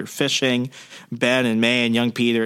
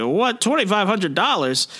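higher, mock-shocked gasp of a question; shocked Aunt May; 7 semitones higher than usual. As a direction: act stunned by the bill, pitch up. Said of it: "May's like".

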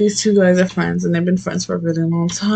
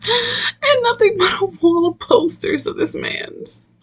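mock sob; 13 semitones higher than usual. Mocking the otaku's obsession.

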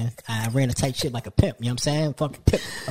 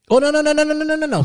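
high, loud string of no's; loud disbelief; 13 semitones higher, 7 dB louder, faster than usual. Real, not acted. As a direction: cry out no over and over in disbelief. Reacting loudly in disbelief.

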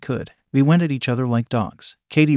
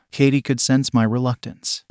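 usual speaking voice

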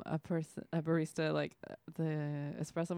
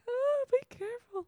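high, fretting mother voice; worried mom. Retold or imitated. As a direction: fuss like an anxious mom. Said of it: "she was like".

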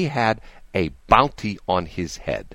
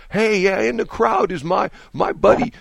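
high, hammed-up folksy campaign voice; Biden impression; 10 semitones higher than usual. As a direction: play the old pol yelling to a buddy in the crowd. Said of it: "he's talking about like".

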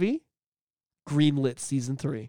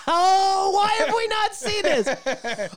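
excited shout; 3 dB louder, much slower than usual. Real, not acted. Excited reaction to new animation.

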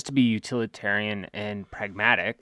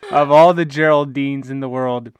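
laughing; 3 semitones higher, 7 dB louder than usual. Real, not acted. Joking, laugh heard.

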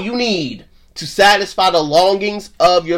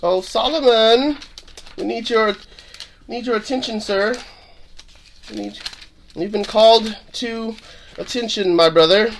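summoning call; 4 semitones higher, 3 dB quieter than usual. Pretend. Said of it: "playfully summoning Solomon to speak".

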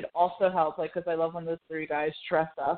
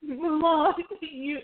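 high, choked-up gushing; close to tears; 8 semitones higher, slower than usual. Retold or imitated. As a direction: gush it as if about to cry. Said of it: "I'm gonna cry right now".

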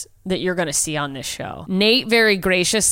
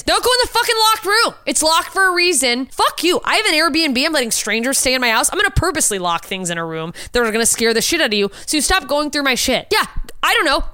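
mock rant; 8 semitones higher than usual. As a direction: rant like a fed-up host. Ranting as Airbnb host.

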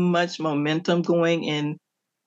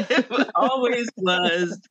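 higher, slow, laughing through the words; real amused laugh breaking into speech; 7 semitones higher, slower than usual. Real, not acted. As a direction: say it through a chuckle. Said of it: "I'm laughing because".

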